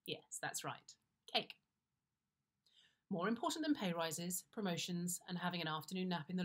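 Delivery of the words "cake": usual speaking voice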